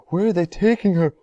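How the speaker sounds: high, loud frantic shriek. A narrator's reading. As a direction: shriek in panic for your child. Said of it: "screeched, shrieked Missus Salt".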